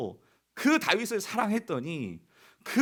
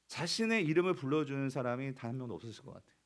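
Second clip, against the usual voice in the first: usual speaking voice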